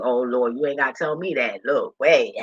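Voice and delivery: normal talking voice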